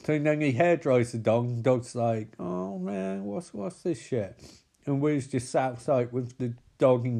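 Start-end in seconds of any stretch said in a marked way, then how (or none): 2.4-4.4 soft, higher-pitched dog voice; grumbling dog. Retold or imitated. Dog under a hair dryer, soft high grumble. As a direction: complain quietly as a bewildered pet.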